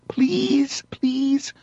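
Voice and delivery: high, hushed whimpering plea; mock childish begging. Man begging police childishly, hushed mock pleading. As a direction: beg in a small, high, softened voice. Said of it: "If you childishly beg them".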